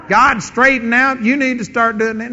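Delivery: a hammed-up scold aimed at God; mock scolding God. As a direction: wag a finger at God, half joking.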